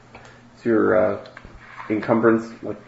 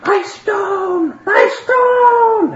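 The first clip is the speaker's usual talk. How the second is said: high, loud, drawn-out shriek; Marguerite's shriek; 8 dB louder, much slower than usual. Acted. Marguerite cries out as someone flies off with it, shrieking. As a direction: shriek it out far above your usual pitch. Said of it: "Marguerite shrieks".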